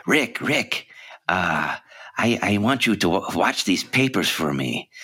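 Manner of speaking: lightly voiced sly underworld man; Peter Lorre voice. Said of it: "his voice".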